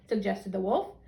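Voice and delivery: ordinary delivery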